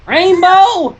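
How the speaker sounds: high, loud call of a name; urgent call. A narrator's reading. Applejack calls Rainbow urgently, high and loud. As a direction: snap her name out fast. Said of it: "Applejack said quickly".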